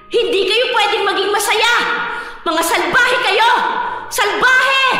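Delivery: crazed, angry outburst; full of rage. Acted. Crazed woman curses a whole family in a nightmare, full of rage. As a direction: rage at them, pitch climbing to the last word. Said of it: "Punong-puno ng galit".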